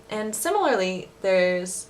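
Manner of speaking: ordinary talk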